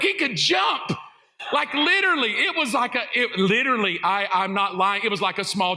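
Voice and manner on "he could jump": panicked thought. Retold or imitated. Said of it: "And then I'm thinking".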